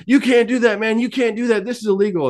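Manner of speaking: very high-pitched panicked objection; freaking out. Retold or imitated. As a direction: imitate a frantic buddy protesting. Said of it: "he's freaking out".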